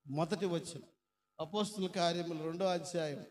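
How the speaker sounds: normal talking voice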